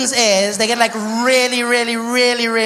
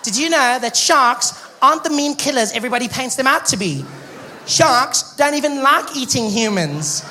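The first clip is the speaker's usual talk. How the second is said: chirpy put-on TV expert voice; higher-pitched mock nature-show presenter; shark expert; 3 semitones higher than usual. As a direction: present it as an earnest shark defender. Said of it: "shark experts. And they come out".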